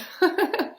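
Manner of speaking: quick high giggle; short laugh. Real, not acted. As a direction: laugh at yourself. Laughs at own imitation.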